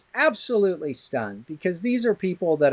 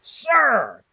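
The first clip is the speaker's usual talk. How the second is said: imitating a sudden high-pitched yell; retold yell; 8 semitones higher, 4 dB louder than usual. Elderly professor being talked over, imitated suddenly yelling. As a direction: cut through the chatter with a mock yell. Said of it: "And then he just yells".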